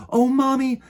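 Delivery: put-on a little girl spotting her dream toy. Little girl voiced in story.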